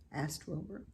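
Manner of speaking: plain reading voice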